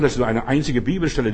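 usual speaking voice

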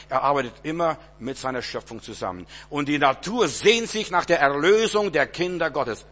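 ordinary talk, nothing marked

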